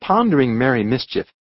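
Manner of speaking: usual speaking voice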